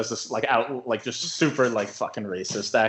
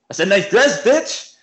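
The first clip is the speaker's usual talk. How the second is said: loud, high aggressive outburst; mock furious shout; retold angry catcaller; 9 semitones higher, 9 dB louder than usual. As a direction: act out an ignored guy yelling his catcall. Retold big guy snaps at woman.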